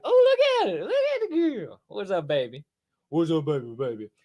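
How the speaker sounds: high cooing pet voice. Real, not acted. Showing his pet Matilda to the chat, high cooing baby talk. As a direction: gush over the pet in a high coo.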